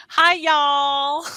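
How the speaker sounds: drawn-out, playful Southern greeting; Southern accent. Shown as a demonstration. Teacher showing how Southerners say hello, Southern accent. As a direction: put on a Southern twang and stretch it. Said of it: "instead of saying hello, they say".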